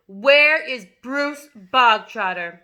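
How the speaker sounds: slow, loud tyrant's demand; bellowing headmistress. A narrator's reading. Headmistress shouting for the boy at assembly. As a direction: roar it across the hall. Trunchbull voice.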